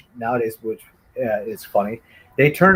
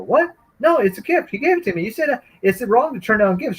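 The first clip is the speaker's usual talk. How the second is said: put-on whiny kid protest; 8 semitones higher than usual.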